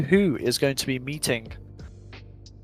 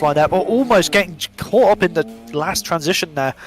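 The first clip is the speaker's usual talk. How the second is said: excited play-by-play, punching key words louder; live race commentary; 3 semitones higher, 5 dB louder than usual. Real, not acted. Commentator calling a drift battle to viewers, excited live commentary. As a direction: call the chase as it happens, driving and insistent.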